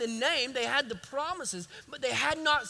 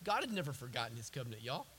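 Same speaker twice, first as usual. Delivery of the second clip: low, soft and quick; hushed aside; 10 semitones lower, 10 dB quieter, faster than usual. Real, not acted. Preacher confides in congregation about God's covenant, hushed aside. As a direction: drop right down to confide it, quickly.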